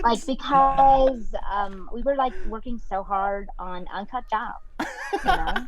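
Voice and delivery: mock Californian actress, stretched words; hammed-up California interviewee; imitated California accent. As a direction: drag out the vowels in a California accent. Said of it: "she kept saying, "Uncut Gems".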